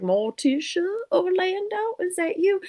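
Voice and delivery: high, raspy-free spirited Whoopi voice; Whoopi Goldberg impression. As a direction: channel Whoopi Goldberg calling out. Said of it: "come in like a Whoopi Goldberg".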